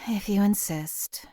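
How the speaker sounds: sigh, then whisper it slowly; weary whisper. Acted. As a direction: give in with a slow, breathy whisper. Reluctant reply to companion's insistence.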